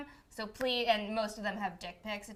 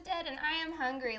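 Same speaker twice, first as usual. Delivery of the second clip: ordinary talk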